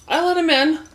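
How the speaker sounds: high, loud exasperated cry; exasperated yell. Real, not acted. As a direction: throw up your hands and yell it. Exasperated punchline shout.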